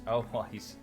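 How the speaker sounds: normal talking voice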